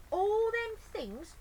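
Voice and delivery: high, loud, slow declaration; solemn vow. Acted. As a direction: announce it grandly. Bill slow resolve.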